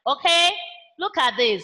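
ordinary talk

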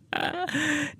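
high, leering cackle; a nasty lecherous laugh. A narrator's reading. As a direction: cackle with lust over her. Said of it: "Gã cười hành hạch".

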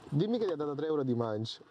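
ordinary talk

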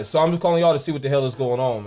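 ordinary talk, nothing marked

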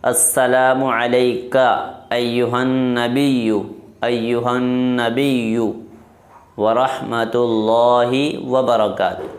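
slow Arabic "ایھا النبی" with doubled n; careful recitation. Shown as a demonstration. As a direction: recite "ورحمۃ اللہ وبرکاتہ" slowly. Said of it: "یہ ہوگا یہاں تک".

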